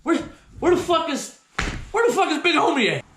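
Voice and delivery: high, halting, stammered questions; bewildered searching. Retold or imitated. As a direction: cast about in mock confusion. Dazed, looking for his friend.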